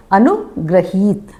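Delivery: अनुग्रहीत said slowly, stressing ग्री; slow model of अनुग्रहीत with ग्री; careful demo. Shown as a demonstration. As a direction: correct form, slowly. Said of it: "ग्री है ना कि री है".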